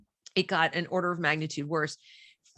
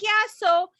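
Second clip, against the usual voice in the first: high-pitched imitation of a child; lightly voiced daughter, pitched up; daughter answering; 12 semitones higher, 4 dB louder than usual. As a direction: answer as a matter-of-fact kid. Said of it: "so she's like".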